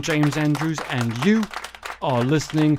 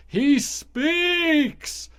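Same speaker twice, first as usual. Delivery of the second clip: pitched way up, hammed-up proclamation; mock awe; 14 semitones higher than usual. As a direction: announce it like a monster coming alive. Mock Frankenstein-style proclamation.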